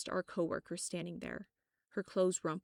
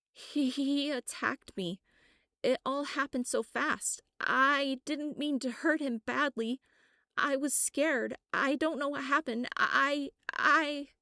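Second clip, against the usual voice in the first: panicked; 8 semitones higher, 7 dB louder than usual. Acted. Sarah panicked after attacking man.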